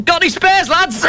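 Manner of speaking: high-pitched mock shout; Scouser calling out. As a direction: pipe it up like calling from outside. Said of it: "Little Scouser outside shouting".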